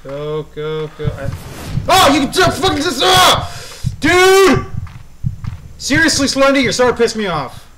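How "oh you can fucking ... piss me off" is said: very high, loud outburst easing into angry warning; scream, then scold the pursuer loudly; startled furious screaming. Real, not acted. Jump scare, rages at pursuer.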